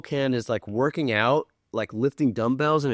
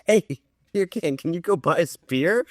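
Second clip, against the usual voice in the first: put-on higher, slower kid calling out; 5 semitones higher than usual. Kid outside liquor store asking beer can, wheedling kid voice. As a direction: call over a favor in a raised kid's pitch. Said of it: "this kid goes".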